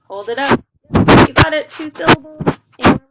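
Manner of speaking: loud, high cheering for the class; excited praise. Real, not acted. Teacher cheering young students on, excited and loud. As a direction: pump them up, loud and proud.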